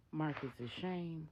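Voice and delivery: ordinary delivery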